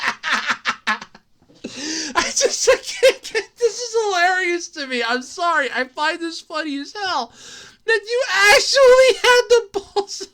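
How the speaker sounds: high, cracking laughter through words; can barely talk for laughing; laughing hard. Real, not acted. Streamer cracking up at the news, real laughing.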